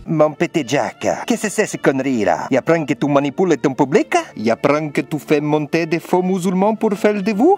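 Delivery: put-on a playful Italian godfather accent, lightly done. Calling Jack on the phone to scold him, in a mock Italian accent. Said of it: "j'ai pris mon meilleur accent italien".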